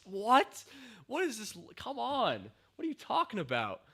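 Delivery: high, stunned incredulity; high incredulous disbelief. Real, not acted. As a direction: squeak out your disbelief at an absurd line. Disbelief at dumb line.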